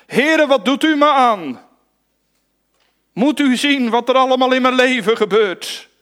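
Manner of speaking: high, loud complaining plea; lamenting cry. Acted. Preacher voicing a lamenting complainer. (hoog en luid klagend)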